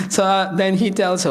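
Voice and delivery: usual speaking voice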